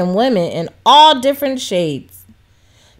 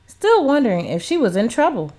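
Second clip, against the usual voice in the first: ordinary delivery, nothing marked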